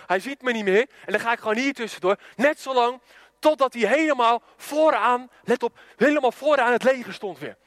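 usual speaking voice

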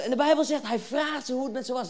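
ordinary talk, nothing marked

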